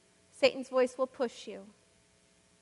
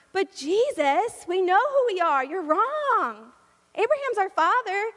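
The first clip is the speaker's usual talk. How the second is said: raised, insistent pushback; indignant protest; 6 semitones higher, 5 dB louder than usual. Acted. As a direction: argue back as if offended. Crowd argues back with Jesus.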